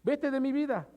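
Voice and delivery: forceful command. Retold or imitated. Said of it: "se traduce".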